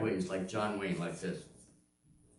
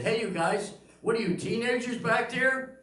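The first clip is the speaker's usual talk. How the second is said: raised, higher-pitched call across the room; calling out teasingly; 9 semitones higher, 4 dB louder than usual. Retold or imitated. Comedian teases couple making out at the back, calling out. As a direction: playfully holler to the back row.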